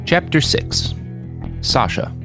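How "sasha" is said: ordinary delivery, nothing marked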